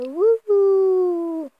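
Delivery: mock spooky.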